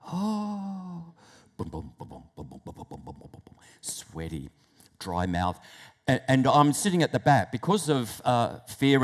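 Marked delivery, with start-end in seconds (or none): 0.1-1.1 high, soft drawn-out gasp; dread gasp. Acted. Acts dread before reading aloud. 1.6-3.5 very low, hushed booming pulse; imitated heartbeat. 3.8-5.6 low, near-whispered symptoms; hushed nervousness. Acted.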